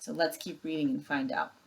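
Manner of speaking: usual speaking voice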